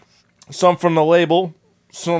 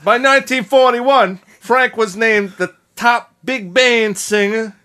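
put-on Italian-American accent; 5 semitones higher than usual. Shown as a demonstration. Host reading [name]'s career script, exaggerated Italian-American accent. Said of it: "read the rest of the script like that".

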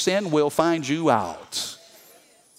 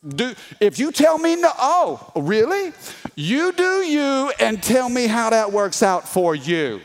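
raised-pitch, mock-incredulous sarcasm; sarcastic challenge; 4 semitones higher than usual. God to Jonah who tells him no, sarcastic.

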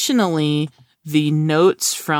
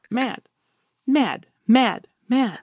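higher, chanted button word; mock button voice; 7 semitones higher, slower than usual. Pressing her own mad button at home, a playful chant. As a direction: play the button, over and over. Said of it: "and just press it".

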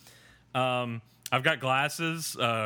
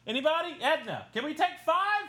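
high-pitched whiny plea; put-on high, pleading voice; pleading mock quote; 11 semitones higher than usual. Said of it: "being like".

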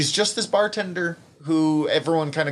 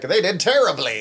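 mock verdict; 5 semitones higher, 4 dB louder than usual. Acted blunt follow-up, raised voice.

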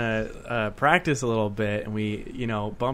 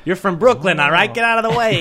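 higher, louder bad Brooklyn accent; annoying Brooklyn accent; 8 semitones higher, 6 dB louder than usual. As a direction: ham up a pushy Brooklyn guy. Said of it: "the worst Brooklyn accent".

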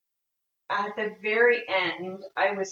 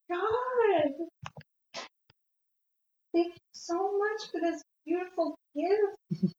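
crying; 11 semitones higher than usual. Real, not acted. Crying after the experience.

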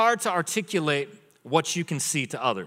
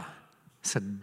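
usual speaking voice

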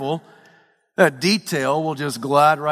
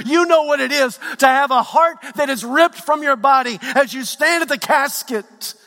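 high-pitched, driving sermon delivery; impassioned preaching; 9 semitones higher, 3 dB louder than usual. Acted. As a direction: build the pain line by line like a fired-up preacher. Preaching at full force.

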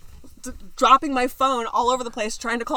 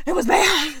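crying voice; 10 semitones higher, 10 dB louder, slower than usual. Retold or imitated. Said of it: "and I'm like".

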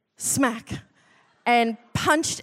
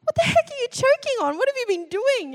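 high, frantic, alarmed wife; panicked alarm; 13 semitones higher than usual. Retold or imitated. Wife woken by choking husband, high panicked alarm. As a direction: shriek in panicked disbelief.

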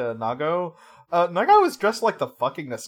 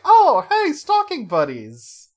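much higher, loud delighted "hey", then a slow "stalking buddies"; delighted greeting; 11 semitones higher, 9 dB louder, slower than usual. Retold or imitated. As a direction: greet a new friend with glee. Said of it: "Wataru's like".

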